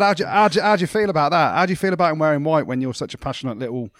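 mock needling. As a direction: wind him up, loud and jokey. Retold needling of the dad.

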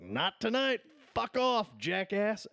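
high, loud mock fury; acted-out angry rejection, voice jumping up; retold flip-out. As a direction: imitate a furious date sending him packing. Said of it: "she flips the fuck out".

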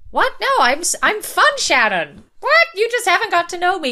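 high, loud mock protest, voice jumping up; defensive squeal. Lydia protesting to Shannon in a squeaky, defensive voice. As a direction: squeak it out like someone insisting they're fun. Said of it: "that thing of being like".

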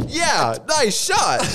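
game announcer. Retold or imitated. Quoting the game voice.

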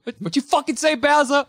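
high, hammed-up challenge; mock tough princess. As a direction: throw it at Bowser like a brawler. Acting Peach threatening Bowser.

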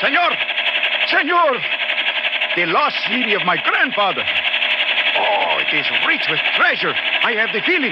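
excited shout. Acted. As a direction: call out in wonder and greed. Guide sees the lost city.

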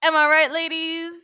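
high, loud hammy club comic; hammed-up stand-up comic. Mock comedian working the room, hammy. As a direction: play to the crowd for a laugh.

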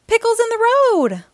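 alarmed warning yell. Acted. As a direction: shout the danger ahead. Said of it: "yells Bandit Tasha".

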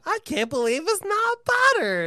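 high, loud ad announcer; commercial voice. Pretend. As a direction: belt it like a TV ad. Ad jingle imitation.

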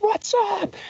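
high-pitched shout to a crowd; stage greeting yell. Retold or imitated. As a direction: hype up the room as you walk out.

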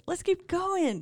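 high-pitched, mock-giddy urging; eager self-quote. Voices herself eagerly pushing on.

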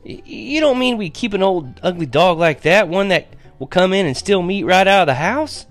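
put-on high-pitched, disbelieving boy; incredulous protest. A narrator's reading. Boy to Mama about the ugly stray, incredulous.

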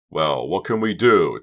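loud, irritated snap; snapping. A narrator's reading. Doug snapping back at Shannon in an argument. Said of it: "Doug snapped".

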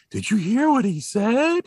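high, slow imitated kid voice; mock shocked child, drawn out; scandalized kids. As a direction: gossip like an astonished schoolkid.

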